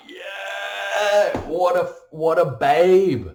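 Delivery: loud, high Aussie whoop; high, loud Australian yell, hammed up; mock Aussie bloke. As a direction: shout it like a brash Aussie spotting a girl. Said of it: "we're just like".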